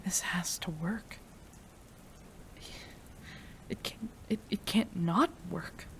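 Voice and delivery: whispered, urgent pleading; anxious whisper. Acted. Scientist waiting over her lover's body, anxious whisper. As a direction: whisper it like a prayer you barely dare say. Desperate wait for the serum.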